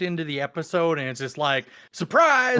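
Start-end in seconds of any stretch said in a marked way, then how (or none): 1.9-2.6 high, loud "Surprise!"; spring it out like a sudden reveal; mock surprise shout. Viewer mimicking the show's abrupt opening, mock surprise shout.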